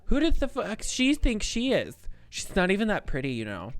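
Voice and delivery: high, loud mock outrage; outraged friend. Improvised girlfriend raging at a rival, high and loud mock outrage. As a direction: rant like a betrayed girlfriend, hammed up.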